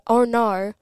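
Australian "oh no", like "oar nar"; Australian accent. Shown as a demonstration. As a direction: say it with exaggerated Australian vowels. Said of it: "I always say".

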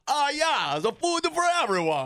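high, hammed-up Greek host; Greek accent. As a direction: announce it like a Greek restaurant owner. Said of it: "they do that in Greece".

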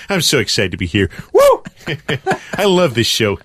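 breathless giddy excitement with high whoops; Tom Cruise. Retold or imitated. Comedian greets the host as an overexcited movie star. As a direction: bounce with manic joy. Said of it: "It's Tom Cruise".

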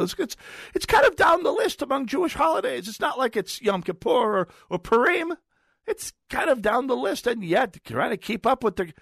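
put-on rising Yiddish accent with a bemused lilt. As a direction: slip into a sing-song Yiddish accent without meaning to. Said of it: "why am I switching into a Yiddish accent".

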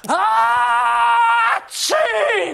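shouted fake sneeze; loud mock sneeze. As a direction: belt out a huge sneeze. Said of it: "É tipo".

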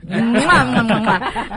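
smacking air kisses between names; loud playful kissing sounds; blown kisses. Real, not acted. Blowing kisses to guests.

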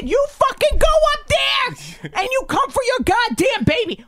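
high, full-volume furious command; angry yelling. Retold or imitated. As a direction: order her off, shouting. Retold yelling at the mother.

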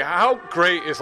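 ordinary talk, nothing marked

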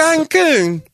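very high British "Cancun"; posh shrieked Cancun; British accent. Retold or imitated. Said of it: "British accents".

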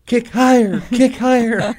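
high, loud barking dance coach; mock dance coach. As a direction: shout up at the dancers like a bossy coach. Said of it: "Sit there and go".

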